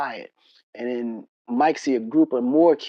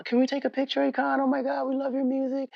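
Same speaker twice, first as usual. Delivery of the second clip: high-pitched, softer imitation; gushing fangirls; 12 semitones higher, 4 dB quieter than usual. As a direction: put on a girlish fan voice. Voicing girls asking for pictures.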